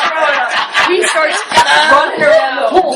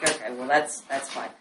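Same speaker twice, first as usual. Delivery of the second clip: resigned mumble; 10 semitones lower, 16 dB quieter than usual. Retold or imitated. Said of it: "So I'm like".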